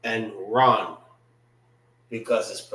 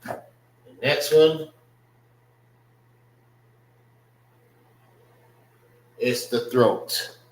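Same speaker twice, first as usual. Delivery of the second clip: drawn-out announcement; slower than usual. Shown as a demonstration. Teacher slowly announcing the next word, drawn out. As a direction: stretch it out like a big reveal.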